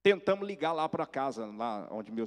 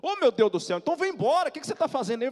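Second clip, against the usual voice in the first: high, loud, acted-out mother; frantic urging; 12 semitones higher, 7 dB louder, faster than usual. Mother urging lost son home, frantic exclaiming. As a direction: exclaim and plead for a return.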